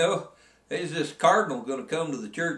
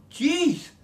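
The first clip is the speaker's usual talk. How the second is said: amazed guide; 12 semitones higher, 4 dB louder than usual. Retold or imitated. Fishing guide gawks at fish.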